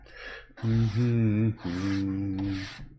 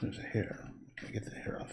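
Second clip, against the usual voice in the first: soft whisper to the dog; 14 dB quieter than usual. Real, not acted. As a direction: breathe the words, no voice. Whispering while grooming the dog.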